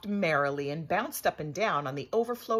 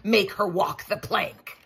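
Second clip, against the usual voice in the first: roaring; 4 semitones higher, 5 dB louder than usual. A narrator's reading. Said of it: "he roared".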